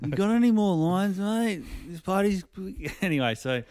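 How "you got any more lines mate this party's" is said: high, loud wired bloke; party guy. Pretend. Plumber at party asking for drugs.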